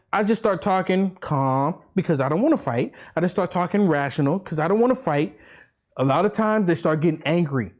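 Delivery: usual speaking voice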